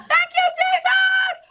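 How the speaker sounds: ecstatic, high, drawn-out shout of thanks; overjoyed shouting praise; joyful praise shout. Retold or imitated. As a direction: cry out thanks to Jesus at the top of your voice. Praising, running through the pod.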